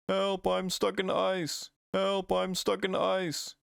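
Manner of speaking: high, slow cartoon plea; mock cry for help. Frozen Pokemon pleading for help, high slow mock plea. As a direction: wail like you're frozen solid.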